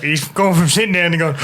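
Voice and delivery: usual speaking voice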